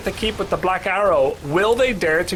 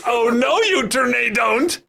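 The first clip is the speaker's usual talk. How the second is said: hammed-up shout at the team; mock villain shout; 8 semitones higher, 3 dB louder than usual. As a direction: playfully yell it as the storm. Voicing the weather, mock shout.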